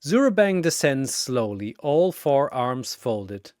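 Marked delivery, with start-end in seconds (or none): none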